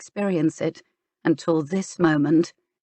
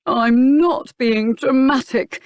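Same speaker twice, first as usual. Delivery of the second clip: slow, high, louder mocking parrot of her words; mimicking mockery; 7 semitones higher, 5 dB louder, slower than usual. A narrator's reading. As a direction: throw her own line back at her, mocking. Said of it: "he mimicked".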